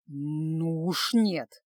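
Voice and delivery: low, very slow, determined refusal; through gritted teeth. A narrator's reading. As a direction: squeeze it out through clenched teeth. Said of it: "процедил сквозь зубы". (медленно цедит сквозь зубы)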